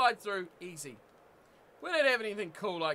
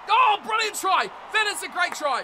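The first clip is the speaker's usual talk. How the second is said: delighted shout; 8 semitones higher, 8 dB louder than usual. Real, not acted. Gamer cheering a great try, delighted shout.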